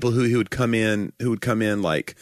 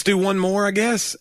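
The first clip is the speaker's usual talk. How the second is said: choked up; 9 semitones higher, slower than usual. Real, not acted. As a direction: voice catching and rising high. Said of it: "It sounded like you choked up".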